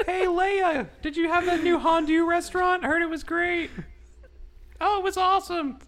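high falsetto chit-chat, barely holding a laugh; high-voiced gossip skit. Asking Leia about the new Hondu restaurant, high comic falsetto. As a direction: play both friends in a squeaky voice.

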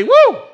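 high, loud "woo!"; whooped "woo". Acted. Preacher reacting to being asked last Sunday, high whoop. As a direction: let out a sudden high whoop.

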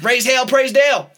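loud, high hollered chant; mock rallying cry. Co-host hears Trump got elected, mock cheering.

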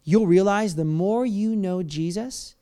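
ordinary talk, nothing marked

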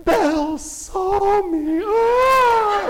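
very high, slow thrilled squeal; little girl rapture. Retold or imitated. Daughter imitated after Belle waved, very high slow squeal. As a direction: squeal it out like an overjoyed toddler. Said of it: "and Kinsley was like".

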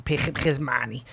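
thick, hammed-up Russian accent; mock Russian accent. Comic quoting the Rounders villain to his co-hosts, mock Russian accent. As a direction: imitate a Russian movie villain.

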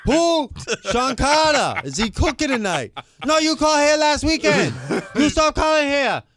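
high, loud put-on voices with a laugh; mock phone quarrel. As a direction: shout it like the fed-up cook. Worker and caller acted quarrel.

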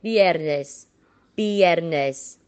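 "Viernes" with a B sound, BYER-nes. Shown as a demonstration. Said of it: "we pronounce it with the B".

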